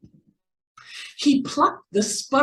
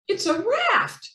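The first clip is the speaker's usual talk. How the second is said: much higher, tiny mouse squeak; squeaky mouse. A narrator's reading. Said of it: "squeaked Mouse".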